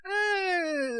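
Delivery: put-on high squeaky drunk voice; mock drunk. Imagining the drunk friend shrugging off the tequila, squeaky. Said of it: "he can't tell, like".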